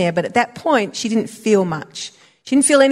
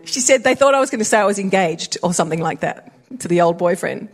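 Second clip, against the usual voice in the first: usual speaking voice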